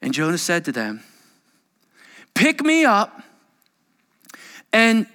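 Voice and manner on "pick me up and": much higher and louder, forceful; dramatic reading. Acted. Preacher reading Jonah's reply aloud, dramatic. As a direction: declare it boldly.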